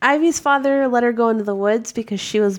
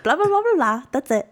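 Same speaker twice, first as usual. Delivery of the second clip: mocking blah; 4 semitones higher than usual. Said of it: "and I'm like".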